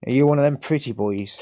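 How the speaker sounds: ordinary delivery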